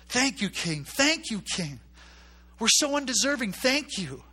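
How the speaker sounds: high, loud grateful cheer; excited cheering crowd. Retold or imitated. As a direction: shout thanks like a crowd grabbing treasure.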